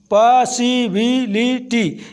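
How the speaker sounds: पॉसिबिलिटी slowly in five beats, पॉ-सि-बि-लि-टी; split into five syllables. Shown as a demonstration. Teacher counting five syllables, पॉसिबिलिटी slowly sounded out. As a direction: give each syllable of पॉसिबिलिटी its own beat. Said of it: "इसमें पांच syllable थे".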